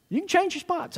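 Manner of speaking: ordinary talk, nothing marked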